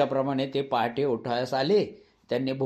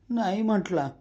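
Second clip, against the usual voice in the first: crying; 7 semitones higher than usual. Acted. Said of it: "मी रडत रडत म्हटले".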